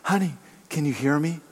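lightly softened, asking his wife gently; softly. A narrator's reading. Old man behind his wife, speaking softly. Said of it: "he spoke softly to her".

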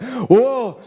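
high, overwhelmed parent; mock overwhelmed. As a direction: play a parent swamped by kids. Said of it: "You're like".

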